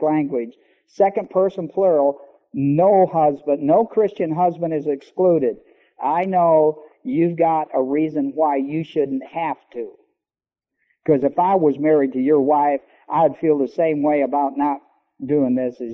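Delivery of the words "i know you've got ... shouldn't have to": ordinary delivery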